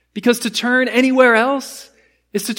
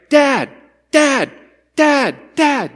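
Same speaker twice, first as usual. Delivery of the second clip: put-on a child calling Dad, higher and louder; 5 semitones higher, 4 dB louder, much slower than usual. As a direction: keep calling out to get Dad's attention. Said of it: "You just keep crying out".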